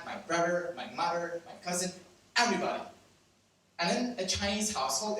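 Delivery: usual speaking voice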